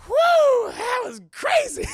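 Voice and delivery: very high, loud mock squeal; exhilarated whoop. Elisa just transformed, acted exhilarated whoop. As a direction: whoop it out in an imitated girlish shout.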